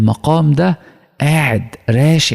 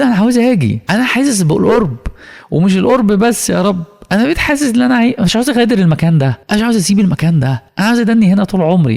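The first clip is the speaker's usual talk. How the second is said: high, fast, breathless plea to God; eager longing; 7 semitones higher, faster than usual. Acted. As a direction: pour it out as a pilgrim who never wants to leave. Pilgrim longing to stay near. (لهفة شديدة وصوت عالٍ وسريع)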